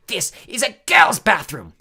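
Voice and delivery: loud, high, slow accusation; suspicious. A narrator's reading. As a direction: draw it out, challenging the intruders. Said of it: "eyeing Harry and Ron suspiciously".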